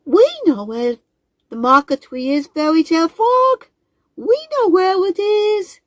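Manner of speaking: high, eager, squeaky squirrel voice; bursting to share the secret; excited little squirrel. Acted. Squirrel voice again.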